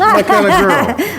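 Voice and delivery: laughing. Real, not acted. Flirty joke about owning a winery.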